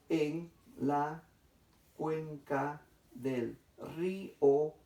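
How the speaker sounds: very slow, segmented dictation; syllable by syllable. Shown as a demonstration. Teacher repeating the syllable exercise, slow and segmented. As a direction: split every word into syllables with clear pauses. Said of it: "vamos a hacer el mismo ejercicio".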